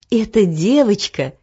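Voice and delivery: usual speaking voice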